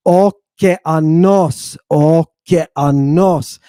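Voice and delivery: "Okeanos" slowly, syllable by syllable, long on "nos"; final stress. Shown as a demonstration. Teacher showing where the accent falls, stressed final syllable. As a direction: draw out the last syllable of "Okeanos". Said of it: "you're gonna stress it".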